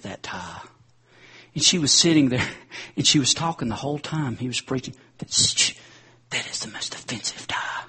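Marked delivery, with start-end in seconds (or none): 5.2-7.8 hushed catty whisper; whispered gossip. Retold or imitated. Lady whispering about the preacher's tie, catty whisper. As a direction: whisper the complaint during the sermon.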